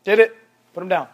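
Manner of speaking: ordinary talk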